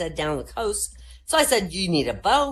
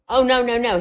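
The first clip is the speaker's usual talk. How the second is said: eager correction; 8 semitones higher, 9 dB louder than usual. Acted. Kid correcting boss.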